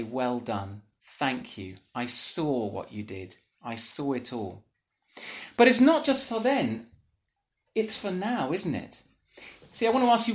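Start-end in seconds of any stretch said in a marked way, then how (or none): 0.0-4.6 softer, lower voice of quiet thanks; tender praise. Retold or imitated. Jesus thanking a faithful servant, softly and gently. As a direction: speak it gently, face to face. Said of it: "he will look you in the eye and say".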